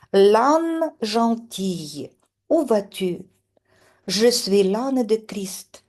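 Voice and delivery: slow, measured reading of verses; poem recitation. Acted. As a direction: read it like a poem for the class. Reads poem aloud. (récitation lente d'un poème)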